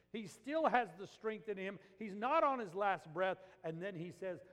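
loud, high, emphatic pulpit voice; forceful preaching. Real, not acted. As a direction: press the point at full volume.

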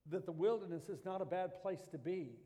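ordinary talk

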